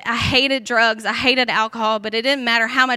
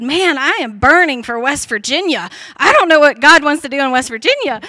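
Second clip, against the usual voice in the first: louder, emphatic retold outburst of zeal; impassioned retelling; 3 semitones higher, 7 dB louder than usual. Telling best friend Christy of a burning for West Virginia, impassioned. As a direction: retell a burning conviction with rising force.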